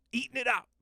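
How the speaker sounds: high squeaky repeat; playful high-pitched echo; mock echo. Echoing own line mockingly.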